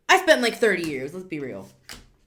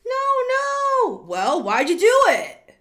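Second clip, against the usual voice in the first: much higher, louder no no then why'd you; mock horrified cry; 9 dB louder than usual. Reader scolding the viewer in mock horrified shrieks. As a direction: shriek it in playful shock. Mock horror at client's choice.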